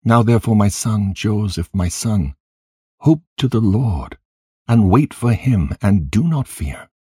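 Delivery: a touch of grief in a consoling prayer; understated lament, urging and consoling; mildly weeping, praying. A narrator's reading. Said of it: "with a voice weeping and praying in these words".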